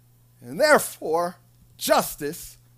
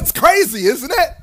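gleeful God; 5 semitones higher, 6 dB louder than usual. Acted. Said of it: "He's like".

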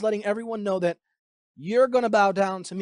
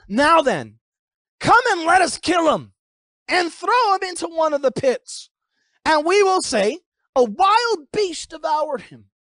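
raised, forceful declaiming; dramatic scripture reading; 6 semitones higher than usual. Acted. Brothers plot against the dreamer, declaimed forcefully. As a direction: proclaim the plot with punch.

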